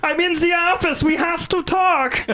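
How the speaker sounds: high, hammed-up German accent. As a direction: squeal it as a cartoon German dictator, stretching the end. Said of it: "that's a German accent".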